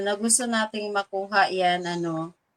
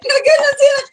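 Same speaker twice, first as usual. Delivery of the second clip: much higher and louder, laughing burst; excited shout; 14 semitones higher, 11 dB louder than usual. Retold or imitated. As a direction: shriek it out in glee. Laughing, mimicking people's reaction. (masayang sigaw)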